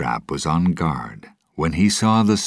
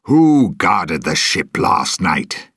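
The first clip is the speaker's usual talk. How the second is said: angry question; 3 semitones higher, 7 dB louder, slower than usual. Acted. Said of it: "he asked angrily".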